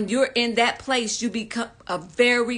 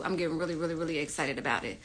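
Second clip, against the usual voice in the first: hushed rapid repeat; 5 semitones lower, 8 dB quieter, much faster than usual. Real, not acted. Host repeats excitement to chat.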